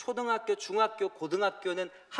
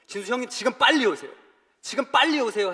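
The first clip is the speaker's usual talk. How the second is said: higher, louder alarmed caller; urgent plea; 7 semitones higher, 9 dB louder than usual. Retold or imitated. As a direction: press him to come at once. Urgent call from the facility.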